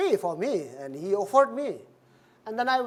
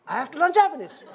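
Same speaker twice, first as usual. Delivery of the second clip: surprised laugh; 8 semitones higher, 3 dB louder than usual. Retold or imitated. Shocked at learning Japanese.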